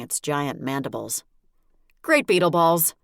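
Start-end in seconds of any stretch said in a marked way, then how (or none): 2.0-2.9 loud, startled outburst; startled exclamation. Acted. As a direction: blurt it out in shock. Demon lunges at her.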